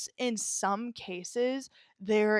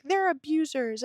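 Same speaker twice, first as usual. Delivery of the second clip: mock accusation; 6 semitones higher, 4 dB louder than usual.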